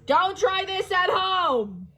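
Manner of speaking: high, bright tour-guide showmanship; showman. Acted. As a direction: present it like a grand attraction. Skipper presenting the waterfall.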